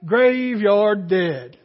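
a slow, low, drawled punchline; slow, hushed country punchline. Retold or imitated. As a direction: drag it out low like a country storyteller. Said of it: "As [name] would say".

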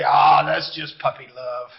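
lightly voiced elder, pitched well down; a hint of an older person waving it off, low; dismissive older folks. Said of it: "the older folks say".

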